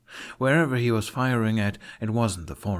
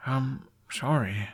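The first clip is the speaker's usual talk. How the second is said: guilty apology; 5 semitones higher, slower than usual. Acted.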